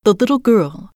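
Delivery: usual speaking voice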